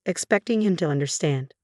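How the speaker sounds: plain reading voice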